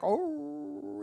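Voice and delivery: high soft howl with head thrown back; dog howl. Retold or imitated. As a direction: howl along like the dog singing. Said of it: "he'll put his head back".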